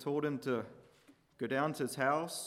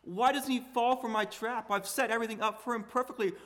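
higher and louder, exasperated, speeding up; frustrated king; 8 semitones higher, 6 dB louder than usual. Retold or imitated. David puzzled by Uriah refusing to go home, exasperated and loud. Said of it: "I think David was frustrated with him".

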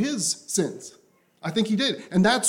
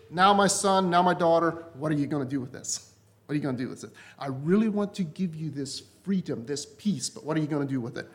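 softer, lower, tender questioning; gentle voice of Jesus; 5 semitones lower, 5 dB quieter than usual. Retold or imitated. Preacher voices Jesus asking his child, soft and tender. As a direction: ask it gently like a loving father.

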